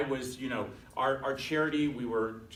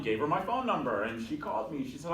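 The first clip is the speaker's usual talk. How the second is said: ordinary delivery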